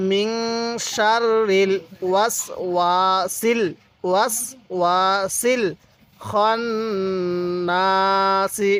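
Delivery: slow drawn syllables, মিং and খন hummed nasally; measured Quran-lesson chant, nasal hold on খন; model recitation. Shown as a demonstration. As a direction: hold the long vowels in ওয়াসিল and না. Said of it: "কীভাবে পড়ব?".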